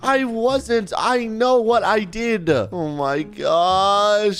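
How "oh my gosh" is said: ordinary talk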